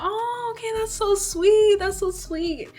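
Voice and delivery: high-pitched mock cooing; gushing manager. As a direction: coo it like an overly sweet boss. Manager coos before the catch.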